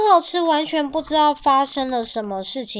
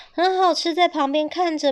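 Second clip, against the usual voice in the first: usual speaking voice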